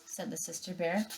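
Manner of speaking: usual speaking voice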